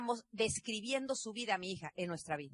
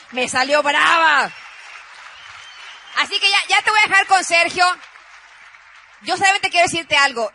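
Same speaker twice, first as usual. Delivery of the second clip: proud loud declaration; 6 semitones higher, 14 dB louder than usual. Real, not acted. Proud emphatic wrap-up.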